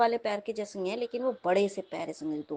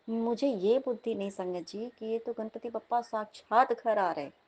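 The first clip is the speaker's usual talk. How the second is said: normal talking voice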